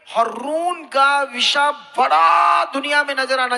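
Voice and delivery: loud, stretched-out swell on बड़ा; preacher's emphatic shout. Real, not acted. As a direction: boom it out, holding बड़ा long. Preacher driving home his point.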